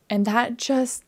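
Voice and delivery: ordinary delivery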